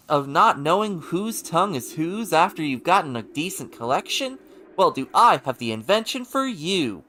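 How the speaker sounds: loud, booming TV pitchman; Billy Mays infomercial. Acted. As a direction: sell it like a late-night ad host. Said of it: "This feels like a infomercial".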